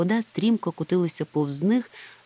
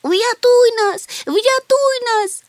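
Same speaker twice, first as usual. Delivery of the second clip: very high, loud drawn-out wail; sobbing plea; 8 dB louder, much slower than usual. A narrator's reading. Trapped Chmykh begs Gemul for rescue, wailing. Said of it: "ридав Чмих".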